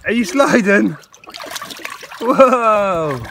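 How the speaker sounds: high, loud startled whoa; surprised shout. Real, not acted. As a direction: call out as they slip. Watching someone slide, calling out.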